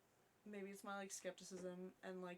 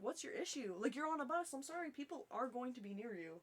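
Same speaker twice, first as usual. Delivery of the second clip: higher, louder indignant protest; exasperated retort; 5 semitones higher, 5 dB louder than usual. Retold or imitated. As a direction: argue back in an acted huff. Retold complaint to bus passenger.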